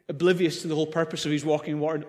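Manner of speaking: ordinary delivery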